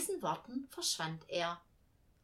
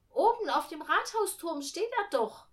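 put-on high, loud child's protest; insistent little girl; 9 semitones higher, 6 dB louder than usual. A narrator's reading. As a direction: insist to mum, pitched up like a child. Millie insists the angel is there.

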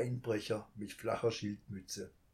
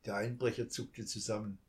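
usual speaking voice

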